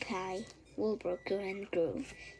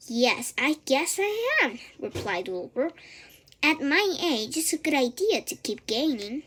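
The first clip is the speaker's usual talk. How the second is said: higher, louder piggy voice; lightly voiced eager young pig; 5 semitones higher, 7 dB louder than usual. A narrator's reading. Wilbur to the old sheep, a higher piggy voice.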